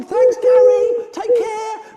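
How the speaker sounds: loud, high farewell shout; calling out goodbye. Acted. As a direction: send thanks after a departing bird. Calling farewell to departing pigeon.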